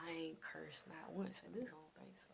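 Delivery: hushed joking whisper. Real, not acted. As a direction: whisper it like a secret. Joking aside about not cursing.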